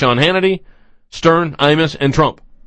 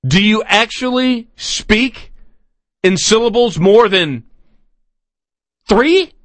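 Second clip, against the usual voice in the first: mocking disbelief; 7 semitones higher than usual. Real, not acted. Host mocking guest's vocabulary.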